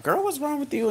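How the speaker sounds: high-pitched mock friend voice; exaggeratedly high questioning; imitated concerned friend. Acting out concerned friends asking.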